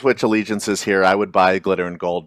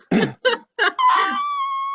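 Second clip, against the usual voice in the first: high squeaky door creak; creaky door. Pretend. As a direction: squeak it out like an old door hinge. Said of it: "You're squeaking it out".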